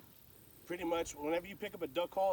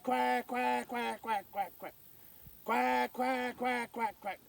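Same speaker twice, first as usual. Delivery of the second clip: hail call quacks; 7 semitones higher, much slower than usual. Shown as a demonstration. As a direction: call out quack quack, cutting each note. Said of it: "So here's your hail call".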